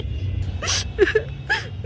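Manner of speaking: a long, high sob at her mother's leaving; high breathy crying wail. Acted. Bride crying at farewell. (विदाई पर दुल्हन का ऊँचा सिसकता रोना)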